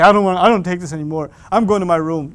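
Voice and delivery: put-on loud, huffy teen refusal; mock tantrum. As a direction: storm off on your parents, half in jest. Storming-off teen, acted quote.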